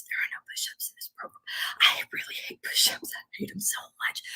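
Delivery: conspiratorial whisper. Acted. Vlogger confessing to her viewers that she hates push-ups, whispered. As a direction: whisper it like a guilty secret.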